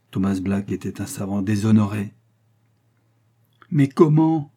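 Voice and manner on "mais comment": loud, high-pitched disbelief; despairing exclamation. A narrator's reading. Disgraced astronomer tearing his hair, despairing exclamation. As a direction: cry out, aghast at yourself. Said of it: "s'écria-t-il".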